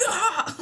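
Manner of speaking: sudden high, loud yelp; startled scream. Retold or imitated. Startling partner while lying in bed, sudden scream. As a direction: re-enact a jolt of panic out loud. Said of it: "scared the crap out of".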